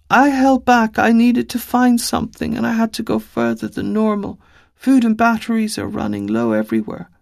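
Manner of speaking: put-on high little girl, matter-of-fact; a small girl explaining herself earnestly; young girl's voice. Little girl telling Five why she stayed away, high and earnest.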